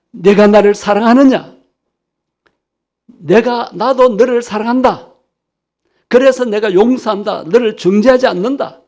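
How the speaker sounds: a full-voiced sermon proclamation of love; proclaiming voice. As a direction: call it out boldly to the whole congregation. Preacher voicing the Lord's call.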